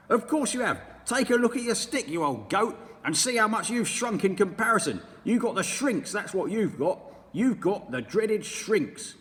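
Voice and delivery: sneering husband, loud; mean old husband taunting his wife; Mr Twit. Acted. Mr Twit's dialogue.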